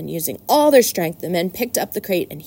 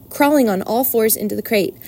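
plain reading voice

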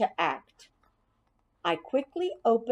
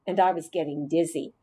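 ordinary delivery, nothing marked

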